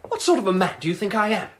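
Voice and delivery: indignant. Acted. Suspect answers the constable's order, indignant challenge. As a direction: throw the word back, offended.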